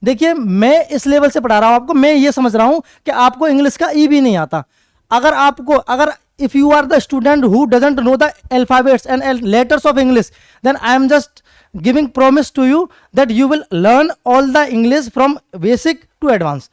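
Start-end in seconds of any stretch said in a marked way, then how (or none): 5.1-16.7 slow, deliberate English, word by word; teacher slowly reassuring beginners; slow earnest promise. Acted. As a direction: spell out the promise slowly to students.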